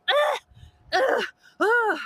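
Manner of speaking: high, loud strained groans; high pitched overwhelmed groaning; retold overwhelmed groans. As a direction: let out shaken groans as it hits you. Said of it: "I just walked out and I was like".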